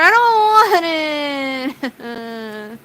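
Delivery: very slow, crying with laughter; drawn-out laughing protest; laughing whine. Real, not acted. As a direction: wail it while laughing. Dreading winter, laughing.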